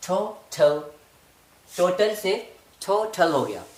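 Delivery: टोटल with a breathy th onset; aspirated t. Shown as a demonstration. Teacher contrasting plain and aspirated टोटल. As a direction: puff the t of टोटल. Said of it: "टी को आपने ठ का sound दिया".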